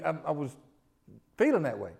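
ordinary talk

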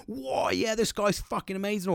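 high, excited gush over a new friend; thrilled. Retold or imitated. Retelling a thrilled reaction to friend.

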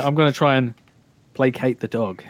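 ordinary talk

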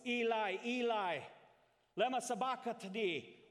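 high, very loud cry. A narrator's reading. Jesus cries out to God on the cross, high loud cry. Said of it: "in a loud voice".

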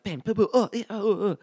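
high, loud bams and yelps; imitated fight screams. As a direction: mimic muffled thuds and cries from upstairs. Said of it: "start hearing all these screams".

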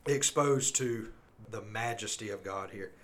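ordinary talk

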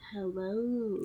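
mock-suave greeting, drawn out; deep, suave imitation. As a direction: tease with a put-on smooth, deep voice. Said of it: "so deep and suave right now".

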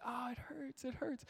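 high, soft mock whimper; whiny little girl. Dad imitating daughter's sore knee, high and soft. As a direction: play the hurt toddler, small and quiet. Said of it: "she's like".

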